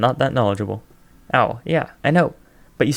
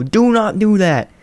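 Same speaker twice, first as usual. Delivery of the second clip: higher and louder, slowly insisting; loud protest; 8 semitones higher, 5 dB louder, slower than usual. Acted. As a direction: plead loudly, drawing each word out. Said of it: "Someone is being louder than Cockatoo".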